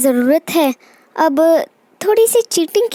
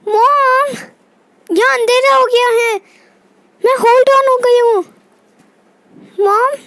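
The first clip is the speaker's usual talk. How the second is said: higher-pitched shout for mom; calling out; 4 semitones higher than usual. Acted. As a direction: call out to mom across a dark house. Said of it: "इतना क्यों चिल्ला रही हो".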